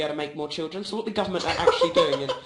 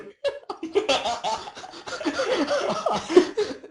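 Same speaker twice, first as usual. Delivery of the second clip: giggling fit; 13 semitones higher, 4 dB louder than usual. Real, not acted. Laughing at own story.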